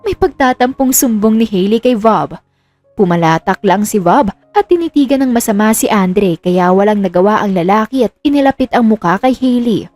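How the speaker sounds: plain reading voice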